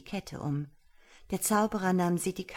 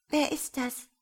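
fearful whisper; 9 semitones higher, 3 dB louder than usual. A narrator's reading. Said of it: "flüsterte sie ängstlich".

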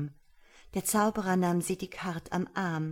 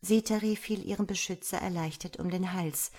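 usual speaking voice

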